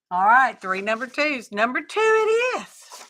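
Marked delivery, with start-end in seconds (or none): 1.5-3.0 high sing-out, held to the end; playful announcement. Pretend. Crafter announcing the chosen number to viewers, playful high announcement. Mock announcing the winning choice.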